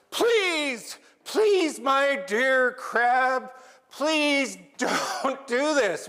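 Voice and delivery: high, loud, slow desperate begging; distraught pleading. Acted. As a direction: Raven pleads frantically to be let go. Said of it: "so distraught".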